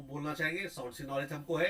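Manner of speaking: ordinary talk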